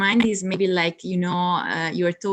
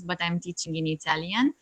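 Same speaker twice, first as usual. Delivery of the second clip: ordinary talk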